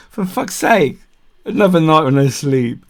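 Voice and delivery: high, fed-up groan of a complaint; exasperated. Retold or imitated. Kept awake by screaming foxes, exasperated moan. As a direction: moan about losing another night.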